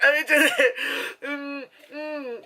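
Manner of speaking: high, choked-up whimper; mock crying. Retelling own reaction to a sad movie scene, mock crying. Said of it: "totally not grind".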